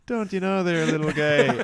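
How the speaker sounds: high, loud Minnesota "don't you know there" with a laugh in it; Minnesota accent. Retold or imitated. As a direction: mimic a chummy Midwestern local teasing a little guy. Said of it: "It's like an Irish Canadian".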